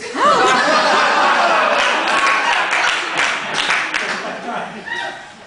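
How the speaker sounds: long high wheezing laugh that rises; helpless loud laugh; breathless laugh. Real, not acted. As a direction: crack up completely. Laughs at the punchline.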